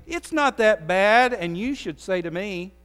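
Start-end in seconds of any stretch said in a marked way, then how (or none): 0.1-1.3 mock excuse. Shown as a demonstration. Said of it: "and I say".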